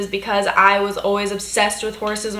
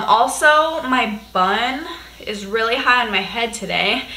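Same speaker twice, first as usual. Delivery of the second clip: ordinary delivery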